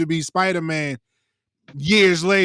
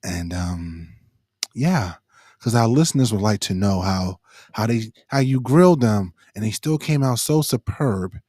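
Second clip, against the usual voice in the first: public radio host; 7 semitones lower than usual. Retold or imitated. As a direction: keep the hushed public radio tone. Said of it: "it's the N P R voice".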